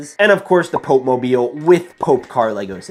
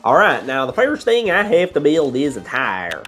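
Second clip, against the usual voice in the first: higher-pitched, hammed-up southern drawl; 6 semitones higher than usual. Toy builder narrating the tire step, southern drawl. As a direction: slip into a put-on southern twang. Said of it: "I'm slipping into a southern drawl more than a Tow Mater accent".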